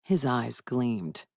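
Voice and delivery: normal talking voice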